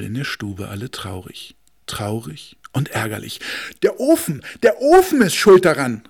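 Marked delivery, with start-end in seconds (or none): 3.8-6.0 high, loud blaming call; accusing cry. Acted. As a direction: cry out who is to blame. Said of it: "riefen sie".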